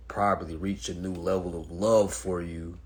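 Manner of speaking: ordinary talk, nothing marked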